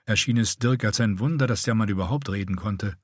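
usual speaking voice

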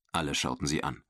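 ordinary delivery, nothing marked

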